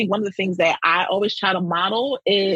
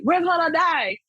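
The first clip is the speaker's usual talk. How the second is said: a quick hammed-up wail of panic, higher pitch; mock panic; 7 semitones higher than usual. A leader mocking a panicked colleague, half-joking wail. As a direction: mimic someone throwing up their hands.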